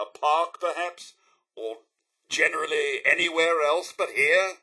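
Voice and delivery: normal talking voice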